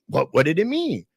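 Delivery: ordinary talk, nothing marked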